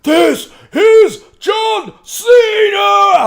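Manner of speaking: huge drawn-out ring announcer bellow, very high and loud; wrestling announcer shout. Pretend. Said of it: "Am I being too loud?".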